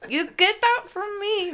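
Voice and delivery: high-pitched boastful grandma impression; immodest grandma. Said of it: "there's no modesty to my grandma".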